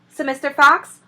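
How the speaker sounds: ordinary delivery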